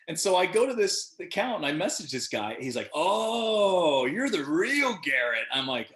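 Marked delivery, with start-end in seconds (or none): 2.9-5.5 high, drawn-out imitated "oh" of discovery; mock amazed recognition. Teen namesake recognizes the actor online, imitated amazement. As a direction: draw it out loudly, imitating a star-struck stranger.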